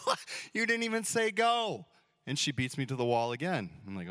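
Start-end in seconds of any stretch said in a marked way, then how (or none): 0.1-1.9 mock outrage. Dad cheated at the start.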